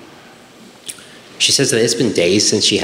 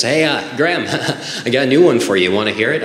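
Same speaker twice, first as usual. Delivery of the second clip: imitated eager dad, higher pitched; eager joking dad; 4 semitones higher, faster than usual. Dad pitching his new joke to his son, eager. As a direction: chuckle partway through, keen to share.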